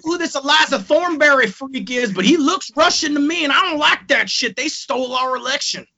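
high-pitched mock Texan; horrendous Texas accent. Texan fan distrusting the Russian-looking wrestler, high Texas accent. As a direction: rant as a bad Texan caricature. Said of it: "my horrendous Texas accent".

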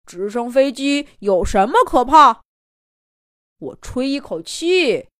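boastful bull. Acted. Boastful bull mocks donkey.

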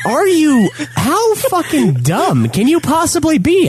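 high-pitched, slow exasperated rant; angry retort. Retold or imitated. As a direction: throw the insult back in disbelief. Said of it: "And I'm like".

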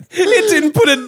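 high laughing words. Real, not acted. Telling a friend about the gift, laughing hard. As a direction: laugh it out helplessly.

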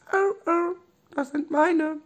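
tiny, very high squeak; squeaky Robby voice. Acted. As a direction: squeak like a small stuffed animal. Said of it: "Robby machte nur ein leises". (hohe, piepsige Stimme)

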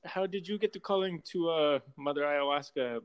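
put-on shaky-aim Costa Rican accent that slips toward Jamaican; attempted Costa Rican accent. Guest imitates the driver asking about Mother Ayahuasca, botched Costa Rican accent. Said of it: "super Jamaican".

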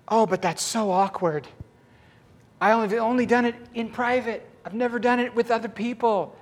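lightly acted embarrassed reluctance. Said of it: "She's just so embarrassed".

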